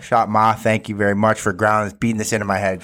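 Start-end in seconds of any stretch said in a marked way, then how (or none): none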